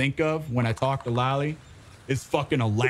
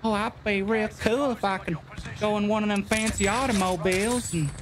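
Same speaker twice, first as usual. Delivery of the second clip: higher, folksy backwoods twang; put-on country bumpkin accent; mock hillbilly accent; 5 semitones higher than usual. Continues the hillbilly bit.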